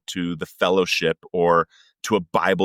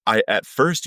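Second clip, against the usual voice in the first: ordinary delivery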